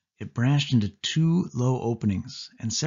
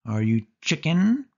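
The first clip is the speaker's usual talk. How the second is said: slow, higher, mocking dare; taunting dare; 6 semitones higher, 4 dB louder than usual. A narrator's reading. Sari dares her cousin to prove he's brave, mocking. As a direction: goad him with a sneer. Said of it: "what she always says".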